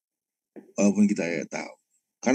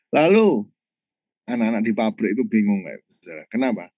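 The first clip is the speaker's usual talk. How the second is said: ordinary talk, nothing marked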